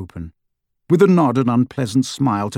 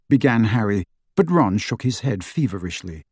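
plain reading voice

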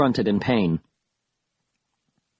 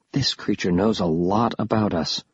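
plain reading voice

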